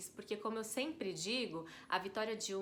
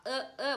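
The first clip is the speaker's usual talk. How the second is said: short, higher grunts mimicking a toddler; grunhido de criança imitado; 5 semitones higher, 6 dB louder than usual. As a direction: imitate a child asking without words. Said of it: "E a criança tá lá". (resmungo de criança imitado)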